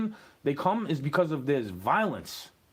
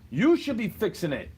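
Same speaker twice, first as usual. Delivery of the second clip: ordinary talk, nothing marked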